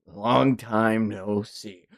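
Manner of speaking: low, very slow, soft greeting; cool returning figure. Acted. Manga character greeting an old foe, low and slow. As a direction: draw it out low and quiet.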